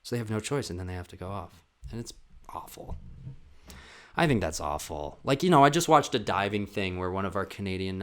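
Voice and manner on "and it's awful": whispered aside. Real, not acted. Vlogger finishing the story, whispered aside. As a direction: whisper it, unvoiced and soft.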